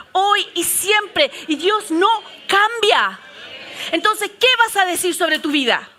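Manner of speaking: predicación más aguda y fuerte, pausada en lo clave; desafía a la congregación con convicción; predicando con fuerza. Acted. Preaches to the congregation. (predica con fuerza y pausa)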